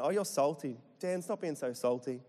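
normal talking voice

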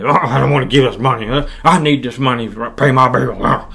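mock whiny, reluctant grumble; hammed-up reluctance to part with cash; grudging. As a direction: groan it out like a grudging giver. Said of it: "and not grudgingly".

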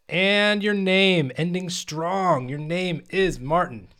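slow, high, building reveal; drawn-out announcement. Real, not acted. Reviewer revealing colleague's final category, drawn-out announcement. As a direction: stretch it out like announcing a winner.

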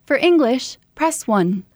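like a recorded customer service greeting; phone menu voice. Retold or imitated. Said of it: "mimic noises".

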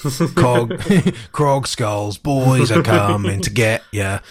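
slow, growled-out threat; menacing orc voice. Pretend. Hammed-up menacing orc name.

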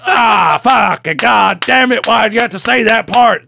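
exasperated mock shout, pitched high; Ed Sullivan. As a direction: groan and yell in hammed-up frustration. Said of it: "And Ed Sullivan's like".

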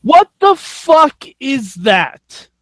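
high, slow, drawn-out disbelief; incredulous outburst. Real, not acted. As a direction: stretch it out in baffled outrage. Outburst at absurd news of co-managers.

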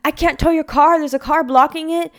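high, loud, impatient retort; gruff tow driver. Retold or imitated. Tow truck driver refusing the tow, loud and impatient. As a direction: throw the problem back at her.

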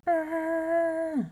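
high, sustained distant holler; echoed yell. A narrator's reading. Well sends back the lion's yell, echoing. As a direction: echo of a yell, held long. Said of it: "voice that came back from the well".

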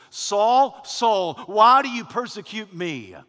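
loud, high divine summons; calling out. Acted. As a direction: confront him from on high with a ringing call. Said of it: "it is the Lord who calls out".

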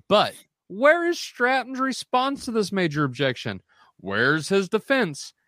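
high, theatrical rhetorical questions; animated reading. Real, not acted. Moderator reads a critic's challenge to the doctor, animated and high. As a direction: pitch soaring, read the challenge with animated flourish. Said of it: "you were a little animated there".

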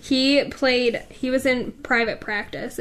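normal talking voice

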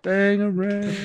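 slow, high goofy voice; high cartoon voice. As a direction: stretch it out in a silly voice. Quoting a film line.

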